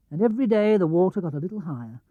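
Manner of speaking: ordinary delivery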